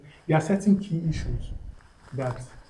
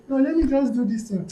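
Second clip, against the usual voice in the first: lively, higher-pitched mock reasoning; higher quoted inner voice, lightly hammed up; acted self-persuasion; 6 semitones higher, 4 dB louder than usual. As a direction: voice a guy talking himself into it. Acting out a man talking himself round.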